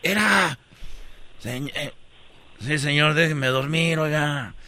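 drowsy low mumble; half-asleep annoyed passenger; sleepy grumble. Acted.

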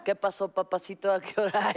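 a mildly sultry tease; flirty come-on. Acted. Lightly flirty woman teasing a papacito. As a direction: lure him in playfully. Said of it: "Lo dijiste tan convincente".

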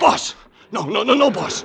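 distraught crying out; anguished cry. Acted. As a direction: cry out in disbelief. Boss is shot.

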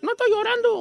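put-on very high little-boy voice; imitating a tearful small boy; little kid denying tears. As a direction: squeak out a childish denial. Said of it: "Era como los chiquillos".

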